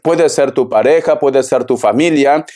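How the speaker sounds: ordinary talk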